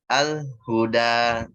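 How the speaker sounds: Al-huda with the voice halting on the l, slowly; wrong: lam stops dead; stopped lam. Shown as a demonstration. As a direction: break the sound at the l of Al-huda. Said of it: "Atau dia berhenti".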